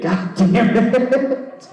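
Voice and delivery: high, slow laughing groan; laughing at self. Real, not acted. Comic scolding herself for the mistake, laughing groan. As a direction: laugh it out at your own blunder.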